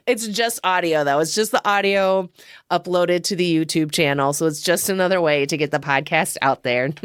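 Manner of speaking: usual speaking voice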